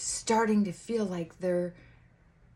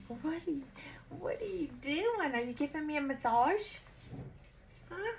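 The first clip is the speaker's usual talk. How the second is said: surprised aside; 8 semitones higher, 6 dB quieter than usual. Real, not acted. Talking to someone touching him.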